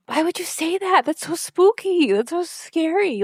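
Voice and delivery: half-joking creeped-out wail; mock spooked. Real, not acted. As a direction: draw it out like a shiver. Spooked by Dean's remark.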